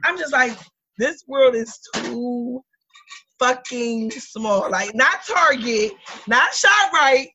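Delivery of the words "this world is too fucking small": drawn out slowly, word by word; slow emphasis. Real, not acted. Complaining to friends that the world is too small, slow and drawn out. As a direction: drag it out in disbelief.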